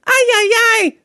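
high, sing-song Latin American exclamation; imitating the nanny's Latin American accent. Imitating the nanny fretting about the kids, high Latin American accent. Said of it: "let's just do with that accent".

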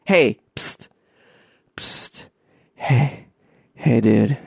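put-on slurring, sloppy drunk whisper; blackout drunk. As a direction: play a wasted guy hissing for attention. Acting drunk to Playboy photographer.

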